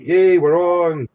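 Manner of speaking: slow, high mock cheer. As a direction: draw out a celebratory yay. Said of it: "So it's like".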